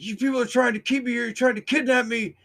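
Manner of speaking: high, loud and fast frightened accusation; imitating panicked man. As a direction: rattle it off like a trapped animal. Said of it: "he's getting really afraid now".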